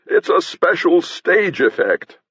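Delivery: high, slow stage proclamation; theatrical shout. A narrator's reading. Masker exposing the fire trick, theatrical shout. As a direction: proclaim it slowly to the gallery. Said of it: "shouted Mebecue in his deepest voice".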